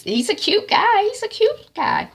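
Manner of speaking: high, swooning gush. Real, not acted. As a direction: swoon over him. Gushing over cute guy.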